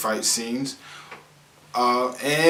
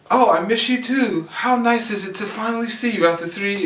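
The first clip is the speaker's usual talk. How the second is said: high, awkwardly sweet reunion; 8 semitones higher than usual. Retold or imitated. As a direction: play it high and awkwardly polite. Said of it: "awkwardly conversing with one another".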